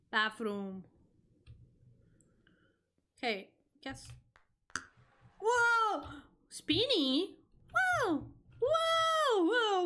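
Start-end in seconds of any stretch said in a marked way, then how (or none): 5.4-9.9 excited whoa. Real, not acted. Caught in a spinning room, thrilled yelling. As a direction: ride the spin with delight.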